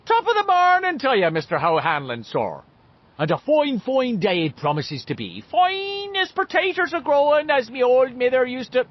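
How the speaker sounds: high, loud stage-Irish brogue; hammed-up Irish blarney; Irish cop voice. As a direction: lilt it like a comic Irish cop. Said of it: "Irish cop voice".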